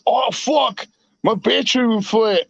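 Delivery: imitated New Zealander with squeezed vowels; New Zealand accent. Friend complaining about his battery, imitated New Zealand accent. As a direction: mimic a Kiwi friend's accent. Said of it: "real deep New England accent ... New Zealand accent".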